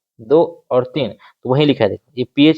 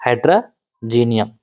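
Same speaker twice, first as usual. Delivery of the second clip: "हाइड्रोजीनियम" slow and lower, in two parts; term broken down; 4 semitones lower, slower than usual. Shown as a demonstration. Teacher answering own question to students, slowly dividing the term. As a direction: break "हाइड्रोजीनियम" apart slowly, pausing midway.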